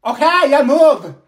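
high, loud exasperated yell; loud shout. Acted. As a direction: snap and shout the order. Teacher losing patience.

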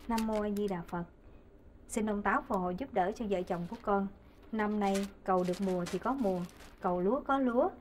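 soft, low prayer voice; praying. Acted. Wife praying to the Kitchen God for her household, softly praying. Prays at the altar.

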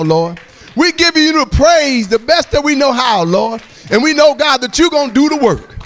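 high, loud preacher's call; full-force preaching. Acted. Exhorting the congregation to lift Jesus up, full-force preaching.

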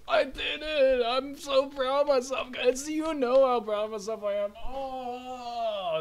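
high, loud, triumphant cheer; super excited. Real, not acted. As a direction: burst out with pride in yourself. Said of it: "I'm sorry for the super assignment".

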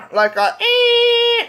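a high, stretched slow "ee"; imitating a beep. Shown as a demonstration. Child shows mom the beep, high drawn-out ee. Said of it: "high-pitched beep".

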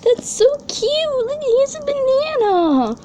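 very high, slow gushing; delighted cooing squeal. Real, not acted. Collector gushing over a banana pet, delighted cooing squeal. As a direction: squeal over something adorably cute.

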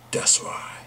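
breathy whispered punchline. Acted. Punchline after long line joke.

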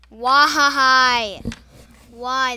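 loud, pained outcry; exasperated shout. Real, not acted. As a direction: cry out at the unfairness. Just died again.

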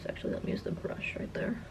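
soft unvoiced whisper. Real, not acted. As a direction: whisper it to yourself. Whispered aside while applying makeup.

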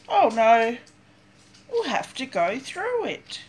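plain reading voice

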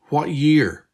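put-on girl demanding the year from her double, insistent; insistent repeat. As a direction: press hard for the answer. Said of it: "Beth repeated insistently".

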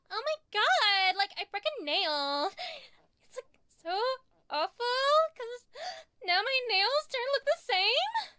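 put-on valley girl whining about a nail. Explaining a valley girl to a friend, in a valley girl voice. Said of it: "talks like this".

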